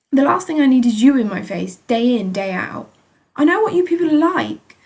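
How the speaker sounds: loud, high furious rant; angry shouting. A narrator's reading. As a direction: tear into the doctor. Angry patient shouting at doctor.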